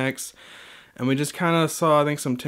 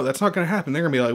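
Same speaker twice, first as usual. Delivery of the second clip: usual speaking voice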